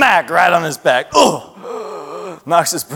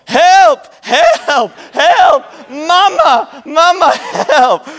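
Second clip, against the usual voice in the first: put-on much higher, louder little girl's cry of help, laughing through it; toddler calling for help; 11 semitones higher, 3 dB louder, much slower than usual. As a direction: play the stuck two-year-old wailing for mama. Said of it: "So she's going".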